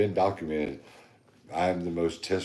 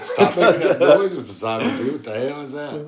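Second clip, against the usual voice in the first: higher, louder, laughing "stop that"; laughing mock yell; 8 semitones higher, 8 dB louder than usual. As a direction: yell at him through laughter, fake-outraged. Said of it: "I was about to be like".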